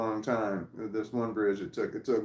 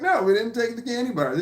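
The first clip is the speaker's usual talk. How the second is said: mock innocent denial; 11 semitones higher, 5 dB louder than usual. Denying to the captain.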